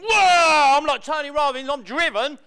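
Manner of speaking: loud mock bravado; mock swagger. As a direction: puff up like a wannabe Tony Robbins. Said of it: "here they all are thinking".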